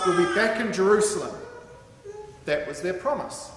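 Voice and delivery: ordinary talk, nothing marked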